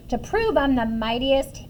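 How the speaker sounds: plain reading voice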